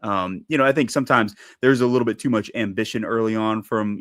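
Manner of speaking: ordinary talk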